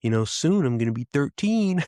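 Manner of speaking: put-on high-pitched little girl announcing proudly; eager daughter. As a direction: imitate an excited child. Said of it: "She's like".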